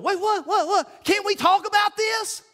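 very high, laughing, alarmed pleading; panicked protest. Retold or imitated. Officer balking at going in, high half-laughing panic.

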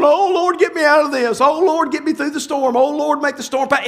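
mock pleading prayer. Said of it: "what we're doing is going".

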